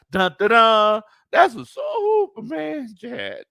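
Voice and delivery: high, very slow, strained jingle like a dying mule. Retold or imitated. Streamer mocking how his jingle sounded last week, dying mule. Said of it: "I sounded like a mule that was dying".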